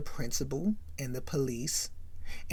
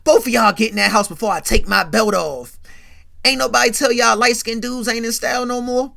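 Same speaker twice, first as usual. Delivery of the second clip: louder, higher mocking yell; loud taunting; 6 semitones higher, 11 dB louder than usual. [name] teasing the brothers, loud mocking yell. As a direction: taunt them loud like a dad with a belt.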